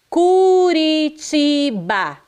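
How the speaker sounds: "Curitiba" alto e esticado, sílaba por sílaba, R leve; R brando. Shown as a demonstration. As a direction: alongue "Curitiba" com tom agudo e R suave. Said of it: "É branda". (erre brando em "Curitiba", bem devagar)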